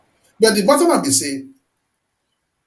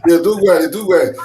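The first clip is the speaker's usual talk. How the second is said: laughing praise; 6 dB louder than usual. Real, not acted. Host laughs approvingly.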